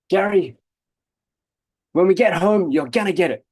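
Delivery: loud, high scolding mother; angry mum. Retold or imitated. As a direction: threaten like a furious mum.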